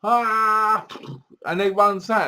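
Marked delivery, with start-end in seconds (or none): 0.0-0.9 high, drawn-out "aah"; hammed-up battle cry; mock cry. Imitates cry during the beheading.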